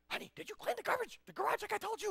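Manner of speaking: very high-pitched mother voice; hammed-up mom falsetto; nagging mom. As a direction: check up on the kid in a high falsetto. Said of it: "then mom's like".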